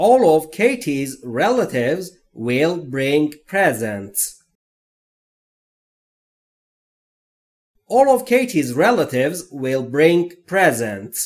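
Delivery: very slow, careful dictation read; each word spaced out and clear; slow model reading. Shown as a demonstration. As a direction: read it slowly for learners to copy. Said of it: "حالا نوبت شماست".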